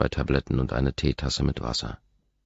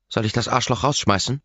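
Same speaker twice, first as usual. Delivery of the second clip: much higher and louder yell from the doorway; Kate bellowing; 9 semitones higher, 5 dB louder than usual. A narrator's reading. Kate at the threshold yells at the unwanted visitor. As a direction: shout it across the room, hostile. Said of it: "brüllt Kate".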